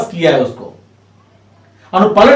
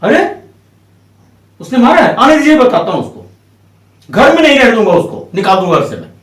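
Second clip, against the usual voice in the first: raised, higher-pitched scolding, acted out; mock threat; 4 semitones higher, 4 dB louder, faster than usual. Father threatening to throw son out, mock threat. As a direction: threaten like an outraged parent, putting it on. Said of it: "इस तरह से धमकी देते हुए".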